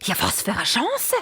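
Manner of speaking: high, eager exclamation; a stork delighted by the chance; mildly thrilled old vizier; excited. Acted. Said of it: "ganz aufgeregt".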